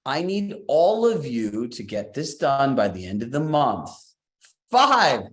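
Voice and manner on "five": excited shout. Real, not acted. Cheering a new answer in chat.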